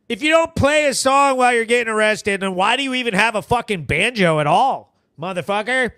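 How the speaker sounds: ordinary talk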